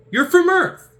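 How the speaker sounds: very high, loud accusation; incredulous accusation. Retold or imitated. Movie exchange about Earth, high loud accusation. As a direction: call him out loudly.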